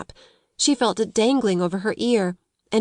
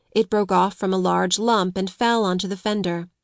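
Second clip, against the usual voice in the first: usual speaking voice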